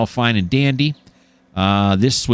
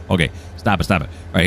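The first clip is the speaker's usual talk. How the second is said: ordinary delivery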